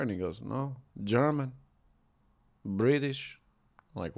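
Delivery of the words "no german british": put-on quiet Mexican-accented driver; soft-spoken Mexican accent. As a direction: imitate the cabbie's Mexican accent, softly. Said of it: "the quintessential Mexican accent".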